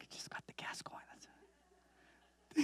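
very soft, voiceless mock-baffled whisper; whispered aside. Helper baffled by a tearful stranger's thanks, whispered aside. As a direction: drop to a whisper as a comic aside.

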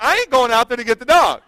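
loud, high-pitched refusal; retold protest. Husband refusing to rescue the dog, loud mock protest. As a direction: protest loudly, pitch jumping up.

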